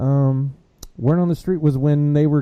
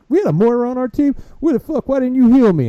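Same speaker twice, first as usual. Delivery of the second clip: whiny gamer yell; 12 semitones higher, 6 dB louder than usual. Retold or imitated. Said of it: "He was like".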